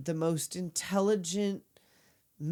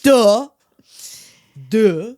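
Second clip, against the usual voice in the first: loud, sarcastic duh; mocking duh; 3 semitones higher, 13 dB louder, much slower than usual. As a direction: throw it back with a loud mock. Mocking reply, cutting in.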